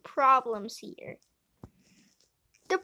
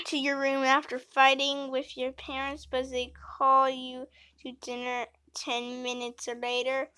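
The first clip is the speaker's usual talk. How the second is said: normal talking voice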